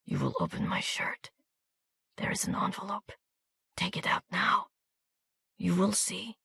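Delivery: hushed, breathy instructions; a dying man giving orders in a whisper; wounded soldier whispering instructions to Miranda; weak whisper. A narrator's reading.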